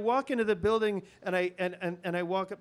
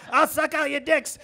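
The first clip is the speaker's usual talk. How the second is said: raised, louder Muppet croak; scabby Muppet voice; 7 semitones higher, 7 dB louder than usual. Retold or imitated. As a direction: play a scabby puppet leaning in the car window. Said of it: "scabby Muppet head pops up ... and goes".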